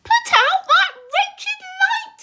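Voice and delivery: high, loud, slow angry centipede; furious shout. Acted. Centipede throwing a boot at the glowworm, furious. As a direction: hurl the order with the boot.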